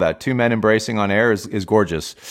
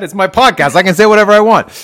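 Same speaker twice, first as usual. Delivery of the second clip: higher, louder, mock-defiant; a playful, defiant boast, rising and louder; 10 semitones higher, 7 dB louder than usual. Real, not acted. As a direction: proudly claim your right to swear. Host defends swearing on podcast.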